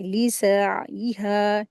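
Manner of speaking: लिसाइहा slow, with a clear throaty ऐन; clear ain. Shown as a demonstration. Teacher showing students the correct लिसाइहा. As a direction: draw out लिसाइहा and sound the ऐन. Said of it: "आइन के मखरज के साथ इसको अदा करेंगे".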